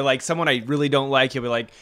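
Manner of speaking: ordinary talk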